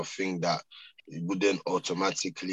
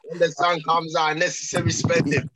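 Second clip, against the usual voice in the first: loud; 10 semitones higher, 8 dB louder than usual. Real, not acted. Loud joking rant about money.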